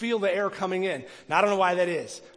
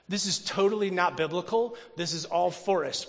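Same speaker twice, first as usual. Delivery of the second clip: ordinary talk, nothing marked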